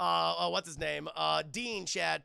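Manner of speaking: normal talking voice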